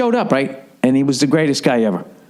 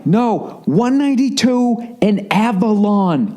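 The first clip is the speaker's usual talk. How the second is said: angry yell; 10 semitones higher, much slower than usual. Retold or imitated. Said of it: "I'm literally getting angry, yelling".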